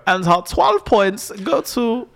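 high, slow German announcer; comic drawn-out German spokesperson; German accent. Acted. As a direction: read out votes in a put-on German accent. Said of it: "it's a great German accent".

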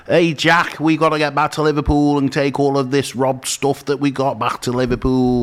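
hammed-up Scouse robber; mock Scouse accent. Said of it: "they put on fake accents".

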